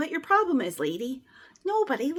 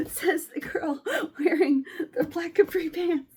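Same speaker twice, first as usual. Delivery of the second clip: laughing; 3 semitones higher than usual. Real, not acted. Self-mocking joke.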